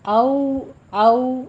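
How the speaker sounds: औ as a glide with the lips half rounded; tajweed demo. Shown as a demonstration. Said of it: "दोनों होंठों को ना तमाम गोल करना है".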